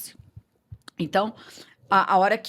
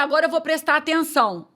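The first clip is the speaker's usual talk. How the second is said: high, loud retold resolve; determined declaration; 7 semitones higher, 4 dB louder than usual. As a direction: announce it emphatically, pitched up. Reencena sua decisão de ouvir. (declaração enfática, em voz alta e aguda)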